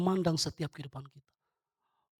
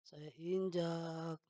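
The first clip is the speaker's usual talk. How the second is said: softer, very slow stomping commentary; drawn-out action narration; 10 dB quieter, much slower than usual. Shown as a demonstration. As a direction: drag the word out as you tread down. Memeragakan uang diinjak. (memanjangkan kata dengan suara lebih pelan)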